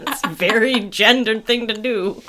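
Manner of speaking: ordinary talk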